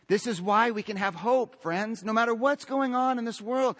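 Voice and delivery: ordinary talk, nothing marked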